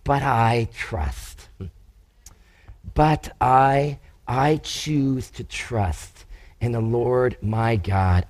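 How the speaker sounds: soft, low, slow declaration of trust; quiet trust. Retold or imitated. David choosing to trust God, soft slow quiet voice. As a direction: say it softly and slowly, like a resolve. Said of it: "He says".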